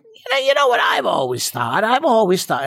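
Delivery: high, pinched, nasal-free squawk of a comic character; Gilbert Gottfried voice. Retold or imitated. Student doing his stage character, high Gilbert Gottfried type voice. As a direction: crank the pitch way up like Gilbert Gottfried. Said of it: "a high-pitched Gilbert Gottfried type of character".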